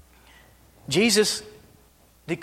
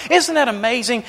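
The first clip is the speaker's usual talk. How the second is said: normal talking voice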